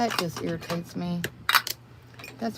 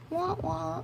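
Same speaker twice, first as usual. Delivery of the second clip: mock sad trombone; 11 semitones higher, 4 dB quieter than usual.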